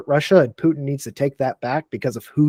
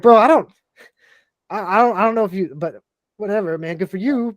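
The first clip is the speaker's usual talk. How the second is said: skeptical brush-off; 7 semitones higher than usual. Retold or imitated. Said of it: "I'm like".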